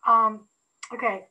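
usual speaking voice

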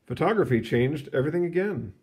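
ordinary delivery, nothing marked

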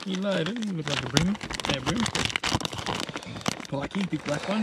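loud, high, thrilled calling; excited calling out. Real, not acted. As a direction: call out the catch excitedly. Calling the fish as it lands.

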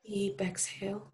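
calm meditation voice with long pauses; guided meditation. Real, not acted. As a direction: gently guide listeners through breathing, unhurried. Leads a gratitude meditation.

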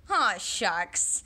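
ordinary delivery

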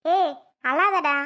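usual speaking voice

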